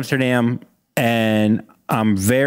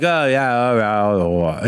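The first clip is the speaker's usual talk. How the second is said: unintelligible Australian mumble; 3 semitones lower than usual. Retold or imitated. Storyteller imitating the star he couldn't understand, mumbled Australian. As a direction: slur it into wordless Australian mumbling. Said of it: "I can't understand him".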